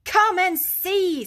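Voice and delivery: high, loud, slow gleeful call; delighted. A narrator's reading. Rat, jigging, calls Mole over in delight. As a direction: beckon him over in delight. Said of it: "said the delighted rat".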